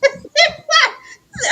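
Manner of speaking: high burst of real laughter; cracking up out loud. Real, not acted. Host laughing at Carson impression, high laugh.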